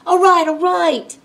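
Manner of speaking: loud, high exasperated groan; worn-down pirate dad, loudly giving up; groaned surrender. A narrator's reading. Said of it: "groaned Pa".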